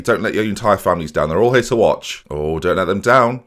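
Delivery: ordinary talk, nothing marked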